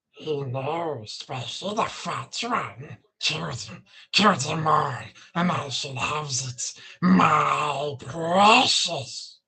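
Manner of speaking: low rasping Gollum, slow and creepy, dragging out precious. Acted. Streamer answers chat request with a low creepy Gollum voice. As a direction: hiss it out like Gollum hoarding the ring. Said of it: "Gollum voice?".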